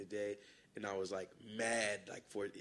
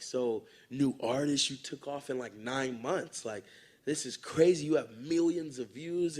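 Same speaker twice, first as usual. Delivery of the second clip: loud, amazed interviewer, slower; hyped interviewer; 4 semitones higher, 8 dB louder, slower than usual. Retold or imitated. Press interviewer gushing loudly at the new artist. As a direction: gush over the new artist. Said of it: "some of the people were like".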